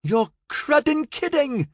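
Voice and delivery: high, slow, stretched-out outrage; outraged cry. Acted. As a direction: howl it in disbelief. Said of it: "Florian cried in outrage".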